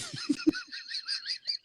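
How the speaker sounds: real high-pitched laughing fit. Real, not acted. Host losing it over his friend's mix-up, high laugh. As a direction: crack up at his own punchline. Tells punchline, laughs hard.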